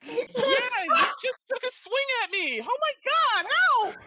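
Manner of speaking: put-on high, loud girl's cry for help; frightened woman. Woman accusing the guard, crying for help. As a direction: squeal it out in a panic. Woman voiced during a scuffle.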